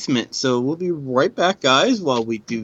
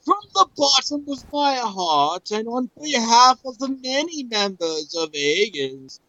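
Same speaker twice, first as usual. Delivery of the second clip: high, theatrical speech to warriors; grand oration; 6 semitones higher than usual. Acted. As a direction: declaim like a heroic leader, in swells. Reads translated letter as grand oration.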